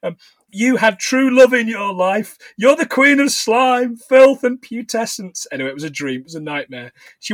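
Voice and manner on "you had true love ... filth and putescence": slow, high declamation; theatrical slow condemnation; hag's denunciation. Acted. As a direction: pronounce each insult slowly with raised pitch.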